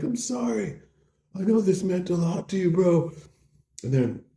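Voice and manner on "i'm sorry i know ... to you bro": high, whimpering apology; hammed-up plaintive regret; mock-pitiful apology. Injured bro apologises to his buddy, pitifully.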